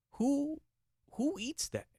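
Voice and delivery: high-pitched disbelief; incredulous squeal. Real, not acted. Incredulous rant about eel.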